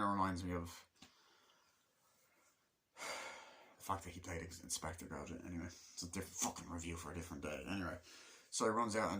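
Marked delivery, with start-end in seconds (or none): none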